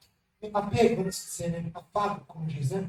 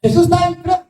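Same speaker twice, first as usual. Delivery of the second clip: louder, higher burst of preaching; loud preaching proclamation; 6 semitones higher, 9 dB louder than usual. Acted. Pastor announcing Jesus to the congregation, loudly. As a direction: announce it to the whole church.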